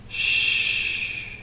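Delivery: soft, drawn-out shush; a quiet breathy "shh"; hushing shh. A narrator's reading. Dead man silencing the killer's dog, soft hushing shh. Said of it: "put a finger to his lips".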